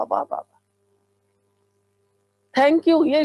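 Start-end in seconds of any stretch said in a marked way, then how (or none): 0.0-0.6 soft whisper of wonder, barely breathed; whispered praise. Shown as a demonstration. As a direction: let the praise fade into a whisper. Repeated praise dying away.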